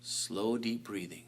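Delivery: very quiet, gentle cue; soft guided breathing. Shown as a demonstration. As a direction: breathe the words softly. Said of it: "Bring your power".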